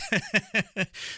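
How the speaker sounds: high, bubbling run of chuckles; amused laugh. Real, not acted. Host tickled they like his accent, high amused laugh. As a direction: laugh it off in quick bursts.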